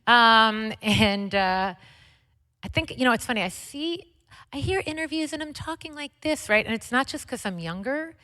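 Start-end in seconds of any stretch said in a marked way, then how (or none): 4.5-6.5 high, light people-pleaser voice; people pleasing. Shown as a demonstration. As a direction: pitch it up sweet to please. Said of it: "that's the people pleaser voice".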